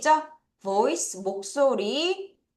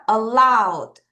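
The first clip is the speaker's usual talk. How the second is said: "Allowed" with weight on LOWED; stress demonstration; 2 semitones lower, 4 dB louder than usual. Shown as a demonstration. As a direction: lean hard on LOWED in "Allowed", letting it fall. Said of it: "여기서 강세예요".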